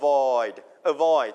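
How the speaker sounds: "avoid" with the stress on VOID; stress on second syllable. Shown as a demonstration. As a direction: punch "void" alone, then "avoid" accented on VOID. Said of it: "เน้นที่พยางค์ที่สองครับ".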